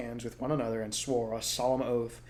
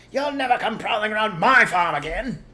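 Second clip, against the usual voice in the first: yelled; 10 semitones higher, 10 dB louder than usual. Acted. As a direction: gloat at the fox. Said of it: "yelled Bogus".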